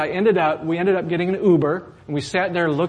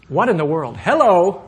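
louder, rising exasperated call at another driver; mock road rage; 3 semitones higher, 6 dB louder than usual. Congregation shown how drivers yell at each other, mock exasperated. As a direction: play the fed-up driver, half-joking.

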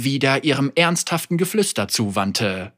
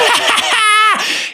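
hohes, lautes Schurkenlachen; böses Lachen; 5 dB louder than usual. Acted. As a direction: diabolisch auflachen. Villain laughs. (böses Schurkengelächter)

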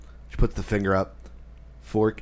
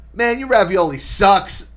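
high, loud, drawn-out disgust; loud complaint; 9 semitones higher, 6 dB louder, slower than usual. Retold or imitated. Aunt after biting into the ravioli, loud disgusted complaint. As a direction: imitate an aunt bellowing her verdict. Acts out aunt's blunt complaint.

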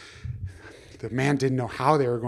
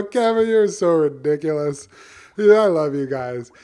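louder, delighted teasing; amused and fond; 3 semitones higher, 8 dB louder than usual. Acted. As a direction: chuckle at the silly fish. Man laughs fondly at his fish.

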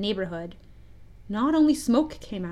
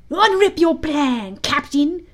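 high, loud, drawn-out eagerness; eager cry; 6 semitones higher, 7 dB louder, slower than usual. A narrator's reading. Smee begging Hook for his plan, crying out eagerly. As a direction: urge the captain on keenly. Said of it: "he cried eagerly".

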